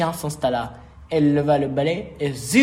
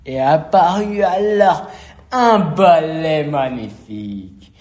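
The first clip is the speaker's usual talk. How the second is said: loud, drawn-out storyteller wonder; grand reveal; 6 dB louder than usual. A narrator's reading. Narrator revealing the new broom to children, marvelling. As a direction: announce it loudly, then stretch it in awe.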